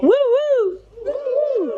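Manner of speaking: high falsetto woo; falsetto flip. Shown as a demonstration. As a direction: flip up into head voice. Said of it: "called a falsetto flip".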